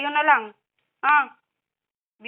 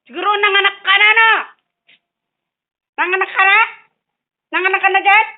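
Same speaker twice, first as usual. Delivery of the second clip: higher, louder teasing interrogation; mock scolding; 5 semitones higher, 8 dB louder, faster than usual. Asking the cat if she gave birth, teasing scold. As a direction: grill the cat, pretend stern.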